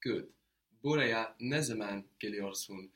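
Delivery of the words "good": ordinary talk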